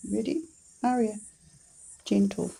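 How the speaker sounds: quiet, gentle command to the dog; soft calm cue. Shown as a demonstration. Owner cueing her dog to take a treat, softly. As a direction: murmur it softly so she stays calm.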